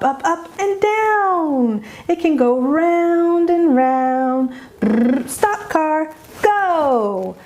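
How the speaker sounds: put-on high, sing-song play talk with a toddler; animated child-directed voice; playful parent. Shown as a demonstration. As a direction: draw out the sounds as you play cars, high and melodic. Models parent playing cars with child.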